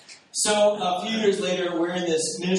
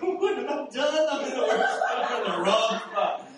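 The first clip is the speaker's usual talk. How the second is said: laughing mock panic; 8 semitones higher than usual. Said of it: "I'm like".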